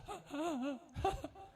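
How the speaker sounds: a rising, scared burst of laughter; nervous laugh. Retold or imitated. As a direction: laugh out of sheer fright. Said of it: "मैं डर के मारे हंस रहा था". (डर के मारे हंसी)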